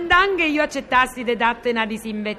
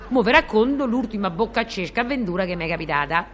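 ordinary delivery

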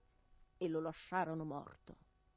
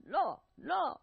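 angry shout; 8 semitones higher, 6 dB louder than usual. Acted. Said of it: "gridò l'altro".

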